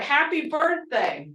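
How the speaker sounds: usual speaking voice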